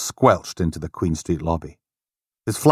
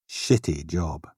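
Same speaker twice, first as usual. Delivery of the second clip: ordinary delivery, nothing marked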